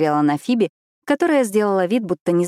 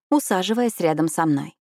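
usual speaking voice

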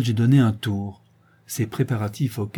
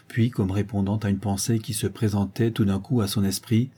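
ordinary delivery, nothing marked

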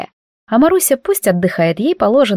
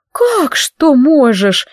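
slow, high astonished question; surprised; 5 semitones higher, 3 dB louder, much slower than usual. Acted. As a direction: act genuinely taken aback. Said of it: "удивилась Евдокия".

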